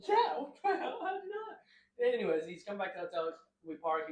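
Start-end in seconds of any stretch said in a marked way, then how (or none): 0.0-1.6 high, astonished question; mock amazement, much higher; amazed. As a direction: squeak it out amazed he knew. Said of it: "He goes".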